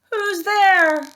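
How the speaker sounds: high, loud startled cry; frightened call. Acted. Baby waking in bed, calling out high and frightened. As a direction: call out scared into the dark.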